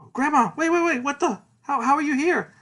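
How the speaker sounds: very high, loud, stammering disbelief; acted bewildered yelp, tripping over questions; confused shock. Boy finds his grandma there, confused shock. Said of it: "He's confused".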